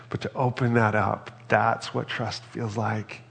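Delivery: ordinary delivery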